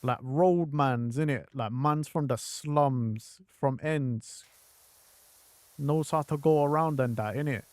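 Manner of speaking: loose London roadman slang, unhurried; roadman London accent. Retold or imitated. Gamer describing a slum character, light London roadman accent. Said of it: "have had man sound like this".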